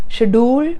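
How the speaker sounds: SCHEDULE as "shuh-DOOL"; wrong "schedule", rising "shuh-dool"; mispronunciation. Shown as a demonstration. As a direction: say "schedule" with weak first syllable, long DOOL. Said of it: "As long as you don't say".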